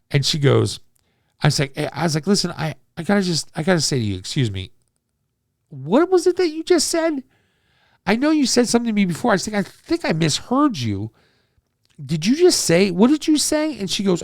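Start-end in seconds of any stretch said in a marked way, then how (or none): 2.3-13.8 high, incredulous asking back; stunned disbelief. Retold or imitated. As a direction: pitch it up in shocked disbelief. High disbelieving question to her.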